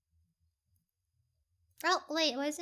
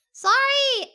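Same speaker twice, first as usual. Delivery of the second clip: louder, higher burst of apology; 7 semitones higher, 8 dB louder than usual. Real, not acted. Streamer to viewers after opening own channel, loud apology. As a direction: blurt a big sorry to the audience.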